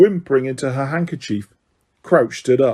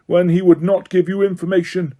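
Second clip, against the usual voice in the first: higher, cold formal sentencing; stern judge Crouch; 6 semitones higher than usual. A narrator's reading. Crouch reading the charges, cold stern judge. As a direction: read the charges without mercy.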